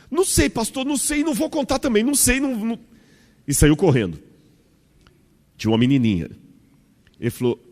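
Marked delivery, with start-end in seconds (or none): 0.1-2.8 put-on very high squeaky kid voice, nervous; a kid squirming away from the question; child dodging the pastor, squeaky and nervous; evasive child. Second child voiced in anecdote.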